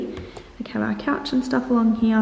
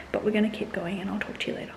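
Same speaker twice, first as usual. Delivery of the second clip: whispering; 8 dB quieter, faster than usual. Real, not acted. Quiet aside while filming.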